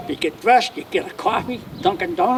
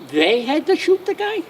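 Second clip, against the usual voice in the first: incredulous; 8 semitones higher than usual. Retold or imitated. Incredulous repeat of the phrase.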